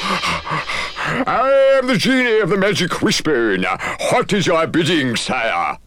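slow, high, booming genie voice; grand genie. Acted. Genie greeting his new master, slow and grand. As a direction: announce yourself like a magic servant.

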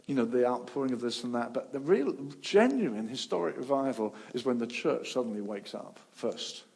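ordinary talk, nothing marked

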